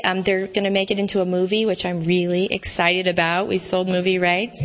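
usual speaking voice

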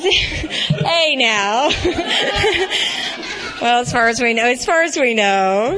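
laughing through the words; giggling out the words. Real, not acted. Laughs at her own joke.

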